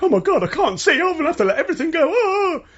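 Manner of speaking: high, fast, squealing panic with a laugh; mock panic. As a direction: ham up a helpless panicking hero. Acting a panicked character.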